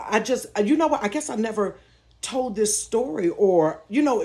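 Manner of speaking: ordinary talk, nothing marked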